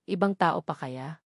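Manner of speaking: plain reading voice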